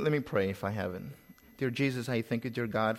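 ordinary delivery

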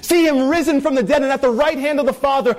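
high, ringing climax; full-force preaching. Acted. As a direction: lift the whole church up high.

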